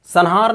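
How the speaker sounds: "संहार" said as san-haar, with an n; mispronunciation with n. Shown as a demonstration. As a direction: put a dental n before the h in "संहार". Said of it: "गलत बोलते हैं". ("संहार" में ङ की जगह न)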